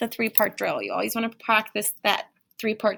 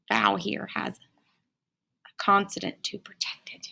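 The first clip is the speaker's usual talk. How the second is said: soft unvoiced whisper; 2 semitones lower, 5 dB quieter than usual. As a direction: whisper it like a secret. Teacher lowers voice to aside.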